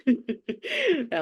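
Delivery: high burst of laughter; laughing. Real, not acted. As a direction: laugh out loud. Laughing mid-story.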